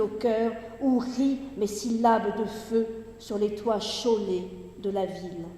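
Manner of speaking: projected, measured poetry recitation with falling line endings; Gedichtlesung. Acted. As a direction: declaim the verse in phrases set apart by pauses. Reads the poem aloud.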